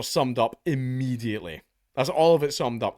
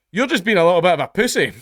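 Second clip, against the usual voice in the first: louder, higher, taunting judge; imitating a judge dismissing a whiny plaintiff, louder and higher; mock scolding judge; 7 semitones higher, 9 dB louder, faster than usual. Judge telling an oversensitive claimant off, loud mocking scold. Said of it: "turn around and go".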